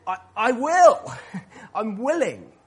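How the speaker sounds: high, loud, emphatic eager yes; eager willingness. Acted. Preacher voicing Jesus answering the unclean man, eager and emphatic. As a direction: burst out with glad willingness.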